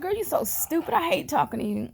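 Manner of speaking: playfully scold, high-pitched; teasing sister. Retold or imitated. Sister's teasing retort retold.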